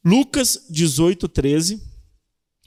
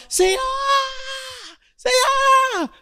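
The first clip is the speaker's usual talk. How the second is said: desperate crying out; slower than usual. Shown as a demonstration. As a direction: cry out to the Lord as if begging for help.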